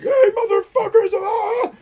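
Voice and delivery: show-character shout. Shown as a demonstration. Streamer showing off his screaming on-show persona, full hammed-up shout. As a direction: blow up like the loud clown he plays on the show. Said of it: "this character I play on this silly-ass show where I scream about everything".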